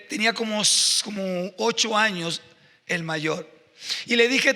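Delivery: usual speaking voice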